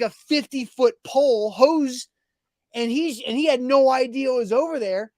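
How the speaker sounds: normal talking voice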